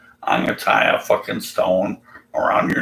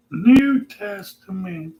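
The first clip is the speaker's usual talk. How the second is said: much higher, slower, put-on voice; 10 semitones higher, slower than usual. Imagined believer insists on the New Testament, high and slow. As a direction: stretch it out smugly, higher. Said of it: "he's gonna say".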